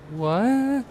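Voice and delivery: sudden high disbelieving What; a shocked yelp of disbelief; surprised. Real, not acted. Gamer sees passengers phase through the wall, high surprised yelp.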